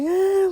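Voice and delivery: high whiny cry sound; imitated toddler wail. Mother imitating her crying son getting dressed, high mock wail. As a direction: mimic a small son breaking into a wail.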